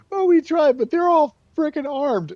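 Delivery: very high, loud whiny excuse; whining enemies. Retold or imitated. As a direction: squeal the excuse like a scared tattler. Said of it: "and they're like".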